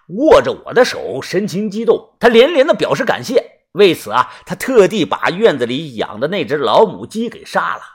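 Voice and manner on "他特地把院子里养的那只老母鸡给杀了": ordinary delivery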